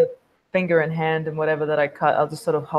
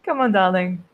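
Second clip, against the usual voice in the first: high sing-out easing down to usual pitch; taunting coaxing; 8 semitones higher, 3 dB louder than usual. Acted. Vampire tempts an addict with a drop, coaxing. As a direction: coax them like a little pet.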